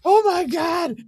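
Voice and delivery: high, squealing voice cracking with laughter; howling with laughter, barely able to speak; laughing through tears. Retold or imitated. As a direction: blurt it out while crying with laughter. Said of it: "I'm crying from laughing so fucking hard".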